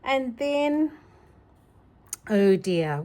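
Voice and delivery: ordinary delivery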